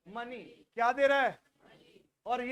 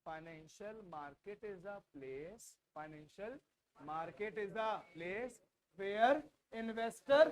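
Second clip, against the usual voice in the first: drawn-out dictation at a low pitch; very slow; 4 semitones lower, 3 dB quieter, much slower than usual. Shown as a demonstration. Teacher repeating a definition for the class, very slowly. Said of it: "बहुत धीरे धीरे बोल रहा हूँ".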